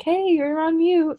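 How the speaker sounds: drawn-out, high-pitched mock yell; mock computer yell. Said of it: "my computer's yelling at me like".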